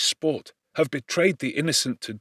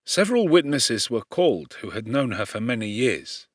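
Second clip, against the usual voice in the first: plain reading voice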